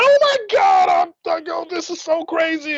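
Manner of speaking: very high, squealing mock excitement; hammed-up thrilled shriek; retold freak-out. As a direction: squeal it like you're overwhelmed.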